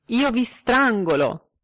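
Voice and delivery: high, loud and slow threat; shouting a threat at the taxi driver; angry threat. Acted. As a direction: hurl it as a furious threat.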